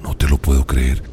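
very low amazed sea voice; disbelief. Acted. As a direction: gasp it out in deep disbelief. (incredulidad en voz muy grave)

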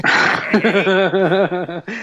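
rising burst of laughter; laughing. Real, not acted. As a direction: laugh along. Laughing reply.

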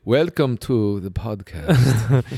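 put-on slow, drawn-out Quebecois French accent; mock Quebec accent. Teasing his cohost, a slow mock Quebec accent. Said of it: "You just sound like you're from, like, Quebec".

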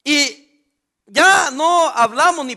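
más fuerte y lento, reprendiendo; grito de predicador. Acted. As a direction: lanzarlo como reproche desde el púlpito. Preacher rebukes the church. (el pastor reprende a la iglesia con un grito lento)

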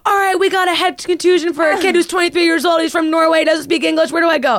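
high, loud, urgent mock doctor voice, taking charge. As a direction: play a TV-show doctor briefing the desk, hammed-up. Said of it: "I literally thought I was Grey's Anatomy".

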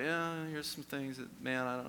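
shy. Retold or imitated. Saying to Rich in the circle, shy. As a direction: hedge reluctantly, feeling your way. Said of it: "being a little shy".

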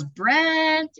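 high ringing call of the name; stadium announcer call. Retold or imitated. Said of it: "you know how we all do, he goes".

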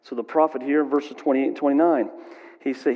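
usual speaking voice

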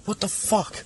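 a lightly acted kid snapping back; mock angry kid. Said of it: "I'd be like".

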